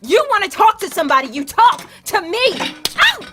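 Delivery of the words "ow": sharp high yelp of pain; high yelp of pain when hit; pained cry. Acted. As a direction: cry out as you're struck. Girlfriend gets hit.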